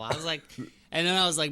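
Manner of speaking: normal talking voice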